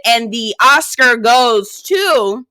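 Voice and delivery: slow, grand announcer voice; mock award announcer. Sarcastic award to Alexis, slow grand announcer. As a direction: announce it like the Oscars, drawn out. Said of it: "I said".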